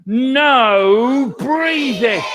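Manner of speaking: a hint of a stern schoolmistress barking the rule; strict teacher command. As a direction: lay down the law like a strict teacher. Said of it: "She used to stand out the front and say".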